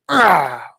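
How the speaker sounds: loud, falling "ah" of frustration; frustrated groan. Retold or imitated. Streamer losing the groove to a muted mic, exasperated groan. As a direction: let out a mock exasperated growl. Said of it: "but I'm just like".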